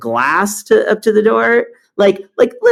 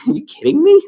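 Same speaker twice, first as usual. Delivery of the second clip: incredulous; 12 semitones higher than usual. Retold or imitated. Retold disbelief at clipboard care.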